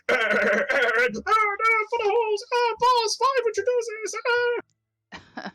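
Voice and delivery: high, slow cartoonish henchman; squeaky whiny goon imitating gunfire; goofy high goon voice. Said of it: "He goes".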